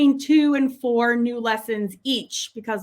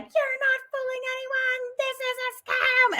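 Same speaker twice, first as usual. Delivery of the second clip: high-pitched imitation of a screamer; mock scream; 13 semitones higher than usual. Imitating a lady attacking the Facebook ad, mock screaming. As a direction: screech it in mockery, high and stretched out. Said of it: "screaming at me like".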